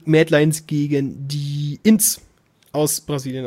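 each word stretched out slowly. Shown as a demonstration. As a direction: speak it very slowly and clearly. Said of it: "jetzt muss ich ganz langsam reden". (ganz langsam und deutlich)

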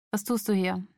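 subdued question, held in check; cool, controlled. A narrator's reading. Man questioning intruder after rage, cool and controlled. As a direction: ask coolly, with the anger gone. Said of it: "Seine Stimme war immer noch kühl, aber beherrscht".

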